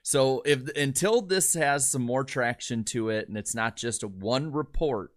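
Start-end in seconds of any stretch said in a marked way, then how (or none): none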